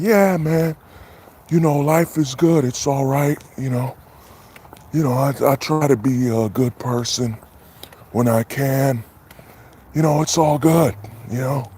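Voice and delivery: mock soft high voice of a big dude; hiding the bass. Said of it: "he's talking like".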